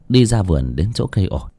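usual speaking voice

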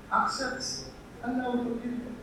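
very soft, high, coaxing voice; hushed, gentle persuasion; gentle appeal. Acted. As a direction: plead softly, as if in confidence. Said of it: "I changed style. I raised my voice. I spoke jeje".

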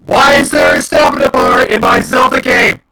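real outrage at the game; indignant wail; furious shout. Real, not acted. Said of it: "I'm so pissed off".

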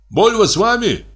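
high-pitched questioning call. A narrator's reading. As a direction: call the question over to another carriage. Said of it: "крикнул Егор Егорыч". (высокий вопросительный окрик)

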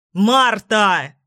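narrator's contained loud call; mild shout. A narrator's reading. Tom calls after Martha across the hotel lobby, mild shout. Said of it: "закричал Том на весь холл отеля".

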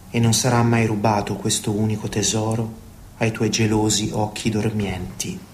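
ordinary talk

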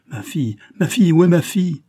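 rising frantic yell; frantic cry. Acted. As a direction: call out for his lost daughter. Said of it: "qui criait".

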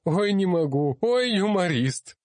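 high, loud wail of helpless mirth; high-pitched mock-sobbing heckler. A narrator's reading. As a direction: squeal it out, stretching the words as if overcome. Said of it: "послышался чей-то всхлипывающий голос".

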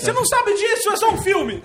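very high, squeaky protest; mock indignation. Real, not acted. Comedian defending the film's hamburger, high mock outrage. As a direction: object in a laughing high-pitched outburst.

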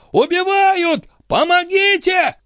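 very high, drawn-out cries for help; screaming out. A narrator's reading. Stationmaster cries for help from the pond, screaming out. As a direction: scream high, stretching the plea. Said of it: "возопил он".

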